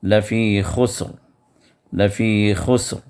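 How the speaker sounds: لفی خسر ending on a heavy stopped ر; خسر with thick خ and full ر. Shown as a demonstration. Teacher demonstrating the heavy ر in خسر. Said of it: "پر ہونے کی شکل ہی میں".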